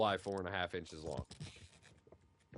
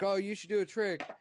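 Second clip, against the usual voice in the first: a hint of an eager kid, higher; lightly voiced little nephew; 11 semitones higher, 5 dB louder than usual. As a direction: pitch it up like a five-year-old urging you on. Five-year-old nephew voiced in story.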